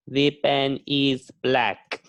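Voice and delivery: slow, word-by-word textbook sentence; stiff unnatural English. Shown as a demonstration. Teacher shows learners the stiff version, slow and careful. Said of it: "People don't speak like this".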